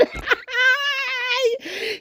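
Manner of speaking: a burst of high shouted laughter. Real, not acted. Vlogger laughing hard at the insult he quoted.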